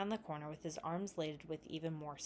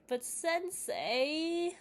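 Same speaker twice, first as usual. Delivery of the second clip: very slow, high, drawn-out whine; whining; 12 semitones higher, 4 dB louder, much slower than usual. A narrator's reading. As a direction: stretch it out like a sulky kid. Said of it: "he whined".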